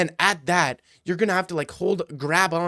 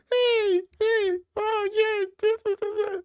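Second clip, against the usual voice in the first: squeaky voice, far higher; mock Hasbulla voice; slower than usual. Imitating Hasbulla for the friends, much higher squeak. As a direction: squeak like a tiny man.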